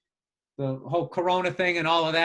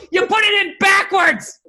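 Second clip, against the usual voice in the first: loud, high, drawn-out exasperated shout; mock yelling; 11 semitones higher, 7 dB louder than usual. Wife corrects husband's swing assembly, mock yelling. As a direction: yell it slowly, in hammed-up disbelief. Wife berating him in retold fight.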